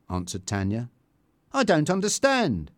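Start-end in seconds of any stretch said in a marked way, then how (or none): 1.5-2.7 higher, mildly baffled; puzzled protest; a touch of bewilderment; confused. A narrator's reading.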